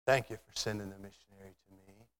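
low, very soft thanks; hushed gratitude. Acted. Stranger thanking Tom in heaven, hushed gratitude. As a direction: say it low and tenderly quiet.

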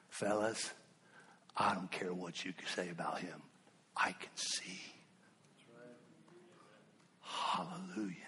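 low, breathless, almost whispered; near whisper. Acted. Preacher whispering the healed man's answer to the Pharisees, hushed. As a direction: drop to a whisper for the punchline.

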